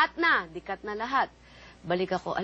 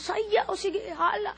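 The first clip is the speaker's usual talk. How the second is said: higher, cheering urge; crowd urging; 11 semitones higher, slower than usual. Retold or imitated. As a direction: egg her on like the crowd.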